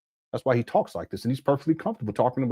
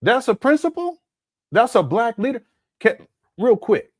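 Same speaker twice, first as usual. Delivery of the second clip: ordinary talk, nothing marked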